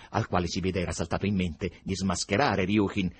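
usual speaking voice

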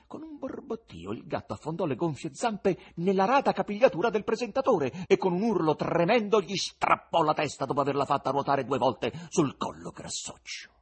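ordinary delivery, nothing marked

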